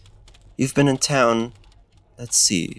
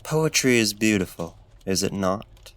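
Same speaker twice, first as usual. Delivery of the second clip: ordinary talk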